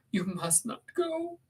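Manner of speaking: slow, high, desperate plea; drawn-out high protest; clinging Catherine. Acted. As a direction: forbid a lover to leave.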